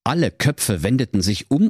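usual speaking voice

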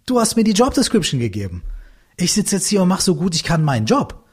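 höher gesprochener Beamter, sachlich verteidigend; innerer Beamter. Retold or imitated. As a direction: den eingestellten Angestellten spielen, deutlich höher. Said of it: "Und der Typ so". (Sprecher spielt seinen inneren Angestellten, höher)

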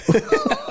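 brief, very high laugh; a quick real laugh; short high laugh. Real, not acted. Laughing at the work example with his cohost, short high laugh.

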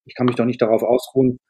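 normal talking voice

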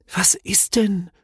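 high, whispery, frightened voice; anxious. A narrator's reading. Snork maiden questioning the Hemul, anxious and whispery. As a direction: ask timidly in a high, airy whisper. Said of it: "fragte das Snorkfräulein ängstlich".

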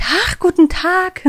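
hammed-up, high and drawn-out delighted greeting; beaming with joy. Cashier suddenly greeting the customer, beaming with joy. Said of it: "freudestrahlend sagt".

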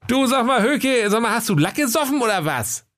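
high, indignant customer; Kaiser's voice. Acted. Kaiser questions the odd shopkeeper, high and affronted. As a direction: challenge him in a high comic voice. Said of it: "Da fragte der Herr Kaiser".

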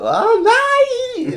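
high loud wail of grief; overdone grief. Pretend. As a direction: wail it like a stage mourner. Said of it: "mega übertrieben traurig".

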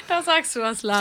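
laughing as she talks. Real, not acted. Co-host laughing at a joke, talking through it. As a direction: talk through a real laugh.

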